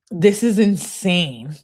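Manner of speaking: ordinary talk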